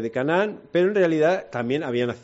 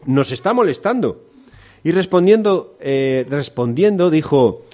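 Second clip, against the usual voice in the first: scripture reading; 3 semitones higher, 5 dB louder than usual. Acted. Reads Bible passage aloud.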